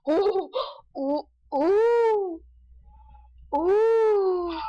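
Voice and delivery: rising awed gasps; surprised ooh. Real, not acted. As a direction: gasp out long oohs. Reacting to game.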